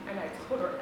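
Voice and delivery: ordinary talk, nothing marked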